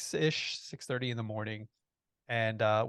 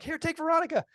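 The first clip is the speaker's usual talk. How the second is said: much higher, frantic retold call; urgent calling out; 4 dB louder, faster than usual. Frantic father hands daughter over to Grammy, urgent call. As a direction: call it out high and hurried. Hurried handover to grandmother.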